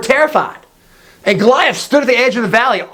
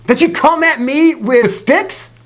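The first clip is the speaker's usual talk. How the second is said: raised-pitch scornful question; taunting giant; 7 semitones higher than usual. Acted. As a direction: play Goliath mocking a small challenger. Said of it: "Goliath says".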